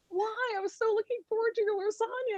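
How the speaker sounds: soft, very high lament; hammed-up tiny wail of betrayal; mock heartbroken whine. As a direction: whimper it like a let-down child. Acts out her heartbroken complaint.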